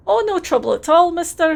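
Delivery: put-on Scottish accent, friendly and a bit overdone; terrible Scottish accent. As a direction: play it as a cheery Scottish lad in a Scottish accent. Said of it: "please enjoy my terrible Scottish accent".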